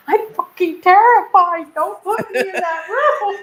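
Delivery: high, slow frightened plea with a laugh; terrified plea. Retold or imitated. Telling her partner she's terrified, pleading. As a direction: plead not to be put back in the room. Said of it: "I'm like".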